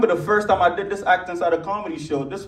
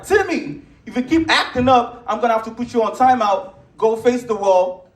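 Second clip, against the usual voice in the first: raised, higher voice of a mom losing patience; acted angry scolding, pitched up on the child's name; pissed mom; 5 semitones higher than usual. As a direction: imitate a fed-up mother scolding her son. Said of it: "the mom gets pissed".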